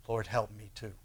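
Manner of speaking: low, soft murmured plea; humble prayer. Acted. Preacher modelling a prayer for oneself, humble and quiet. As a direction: pray it quietly, voice dropped.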